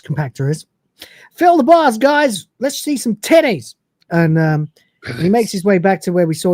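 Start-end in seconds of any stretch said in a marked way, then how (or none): 1.3-3.7 rowdy call. Acted. Acted rowdy call in retold scene.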